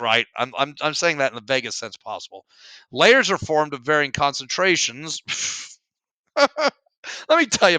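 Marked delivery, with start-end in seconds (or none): 6.1-7.8 laughing. Real, not acted. Laughs at the word again.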